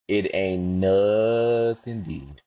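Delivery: slow, drawn-out, emphatic; slow, weighty declaration of love for the South. Shown as a demonstration. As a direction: lean on every word slowly. Repeats the claim slowly.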